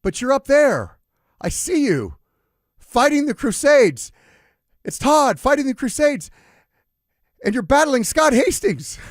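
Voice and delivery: put-on high, loud hallucinating old man; confused grandpa. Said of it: "I saw this extreme confusion on his face".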